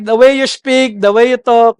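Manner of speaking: ordinary talk, nothing marked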